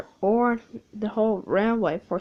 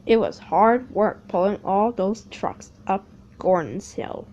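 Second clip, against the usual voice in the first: ordinary delivery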